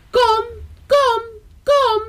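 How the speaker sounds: very high, piping calls; calling parent birds. A narrator's reading. Parent birds urge their young to fly, high calling. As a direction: call out in three separate high notes. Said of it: "riepen ze".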